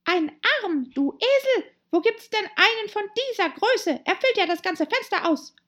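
high, loud scolding animal voice; exasperated Rabbit. Acted. Rabbit calling Pat a donkey, high and exasperated. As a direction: berate the servant in a high, outraged voice.

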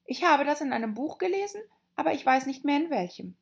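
put-on high, quick girlish voice; Alice rattling it off. A narrator's reading. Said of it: "sagte Alice".

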